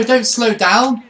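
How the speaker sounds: normal talking voice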